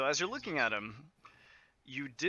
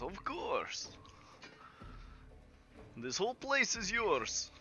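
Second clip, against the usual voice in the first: high, emphatic generosity, building louder; effusive bar owner; 9 semitones higher, 7 dB louder than usual. Acted. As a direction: gush as a grateful host. GM acts Hugo giving everything away.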